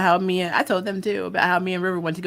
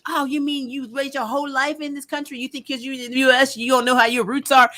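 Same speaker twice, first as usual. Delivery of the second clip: higher, indignant mock rant; going off; 5 semitones higher than usual. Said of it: "it just went off on me".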